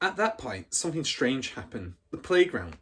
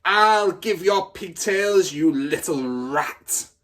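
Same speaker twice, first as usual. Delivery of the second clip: higher, louder, slow, straining; furious yell; 7 semitones higher, 8 dB louder, slower than usual. Acted. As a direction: yell while swinging her round. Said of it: "the Trunchbull was yelling".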